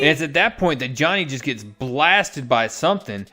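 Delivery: ordinary talk, nothing marked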